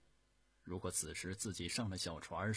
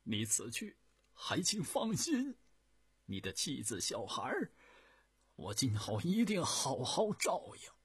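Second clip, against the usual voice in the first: tearful promise; 6 semitones higher, 5 dB louder than usual. A narrator's reading. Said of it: "一边含着泪花说".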